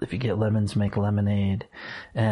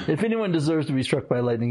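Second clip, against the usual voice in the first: usual speaking voice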